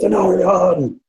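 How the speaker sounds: high, drawn-out war cry; battle shout. Acted. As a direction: shout the name high, falling off at the end. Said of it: "and he shouts".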